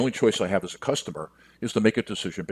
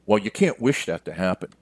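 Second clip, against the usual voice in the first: normal talking voice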